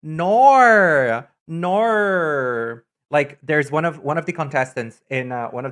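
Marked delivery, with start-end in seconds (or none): 0.0-1.3 Australian "Nor." drawn out loud and high. Shown as a demonstration. Demonstrating how Australians say no, in a strong Australian accent. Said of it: "sounds like a very strong nor". 1.5-2.8 Australian no. Shown as a demonstration. Echoing the Australian no for chat, in an Australian accent. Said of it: "sounds like a very strong nor".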